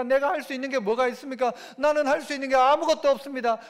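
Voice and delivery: ordinary delivery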